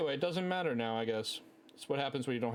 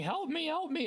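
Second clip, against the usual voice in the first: retold yelp for help, pitched much higher; mock panicked cry. Friend carried off by a game monster, mock panicked high cry for help. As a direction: ham up a friend's panicked plea. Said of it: "he's, like, screaming and crying out".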